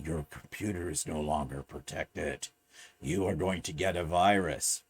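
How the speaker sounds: low, hushed mock warning voice; scam warning message. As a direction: drop low and soft like an ominous automated alert.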